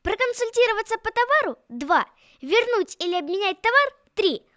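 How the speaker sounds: ordinary delivery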